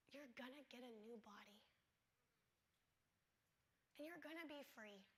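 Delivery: soft unvoiced whisper. At dying sister's bedside, whispered tender comfort. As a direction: hush it to her like a secret comfort.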